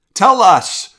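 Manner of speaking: loud, high demand. A narrator's reading. A third cave dweller demands answers, loud demanding shout. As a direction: bark the order at the stranger. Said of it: "demanded a third".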